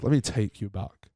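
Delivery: a soft hushed flashback lead-in; dreamy reminiscing. Shown as a demonstration. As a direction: ease into a nostalgic flashback. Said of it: "Reminisce music there".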